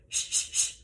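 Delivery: three short unvoiced shh bursts; short shush pulses. Shown as a demonstration. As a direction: pulse the belly on each shh. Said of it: "repeat after me".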